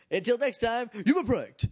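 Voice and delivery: loud, high catchphrase shout; shouted sign-off. Acted. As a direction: belt out the sign-off.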